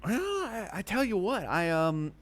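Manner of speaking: high, hesitant drawn-in patter; schmoozing customer. Acted. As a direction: stall affably while buttering him up. Player as Larry indulges the Minotaur.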